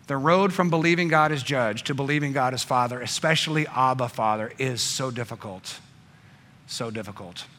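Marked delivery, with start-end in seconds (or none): 3.0-7.5 much softer and lower, heavy; hushed. Real, not acted. Preacher admitting the journey is hard, hushed. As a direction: let it sink to a hush. Sermon moment.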